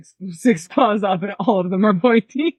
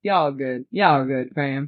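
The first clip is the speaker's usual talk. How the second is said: put-on lower, aggressive Southern accent drawl on y'all; 7 semitones lower, much slower than usual. The player suggests the druid says it in a heavy Southern accent. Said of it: "a really aggressive Southern accent".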